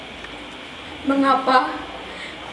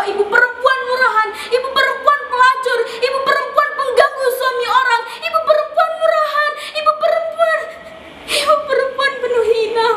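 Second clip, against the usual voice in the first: climbing higher, insults stacking up; rising bitter outrage; 6 semitones higher than usual. Acted. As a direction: build each insult higher and sharper. Villagers' insults building up.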